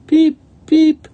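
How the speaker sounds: two piercing, very high peeps; sandpiper peeps. A narrator's reading. Sandpipers at the shoreline, high loud peeping. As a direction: cheep loudly like shorebirds. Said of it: "The sandpipers at the shoreline".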